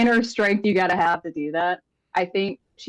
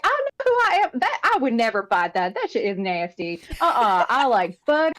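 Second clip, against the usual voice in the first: character voice; 9 semitones higher than usual. Acted. Player voices character's self-talk.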